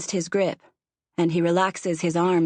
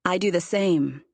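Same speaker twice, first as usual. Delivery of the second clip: usual speaking voice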